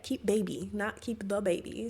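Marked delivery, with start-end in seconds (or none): none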